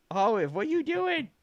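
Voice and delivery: high playful dog talk. Real, not acted. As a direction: coo at the dog. Talking to dog.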